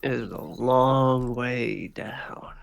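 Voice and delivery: slow, breathy threat whispered close; menacing whisper. Acted. Crime boss whispers to the dangling thug, slow and menacing. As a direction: whisper it slow, savoring the drop.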